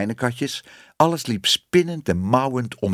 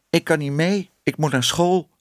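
put-on high-pitched child's regret; mildly disappointed, pitched high; regretful; 7 semitones higher than usual. A narrator's reading. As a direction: say it sorry to miss out. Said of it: "zei Bibi spijtig".

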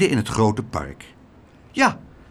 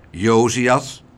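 louder, stern rising address; icy cold voice; 5 dB louder than usual. A narrator's reading. Klaterhoen confronts Josias about the food, icy cold. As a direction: say the name as a frosty warning. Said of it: "met ijzig kille stem".

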